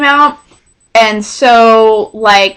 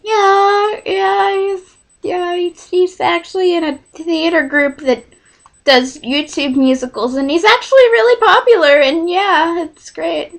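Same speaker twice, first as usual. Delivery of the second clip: higher, softer, politely casual; a mild play at cheerful politeness to the hostess; polite yeah; 5 semitones higher, 4 dB quieter than usual. Retold or imitated. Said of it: "But to her, I was like".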